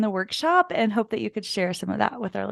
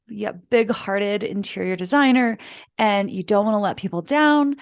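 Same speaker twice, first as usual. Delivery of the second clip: usual speaking voice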